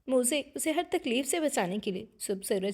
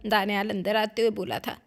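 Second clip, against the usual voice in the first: usual speaking voice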